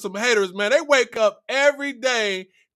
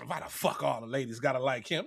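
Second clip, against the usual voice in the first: grumbled much lower and softer; jealous dude; 9 semitones lower, 11 dB quieter than usual. Retold or imitated. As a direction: sulk like a jealous guy. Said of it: "some dudes out here that really be mad".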